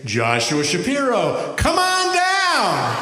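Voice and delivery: loud, high, stretched game-show call; gleeful game-show host summons. Retold or imitated. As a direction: bellow it like a TV game-show announcer.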